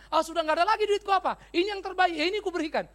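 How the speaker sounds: high, loud self-defence; quarrelling spouse. Acted. Acted spouse defending giving away ring.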